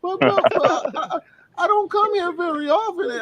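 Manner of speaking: very high, stammering mock protest; protesting casino patron. Playing the ejected gambler pleading with the pit boss, high and stammering. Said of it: "You need a virtual pit boss".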